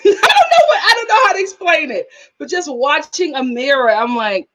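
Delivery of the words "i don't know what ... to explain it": high burst through a laugh; amused, flustered outburst. Real, not acted. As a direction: laugh while fumbling to explain. Bursts out laughing while explaining.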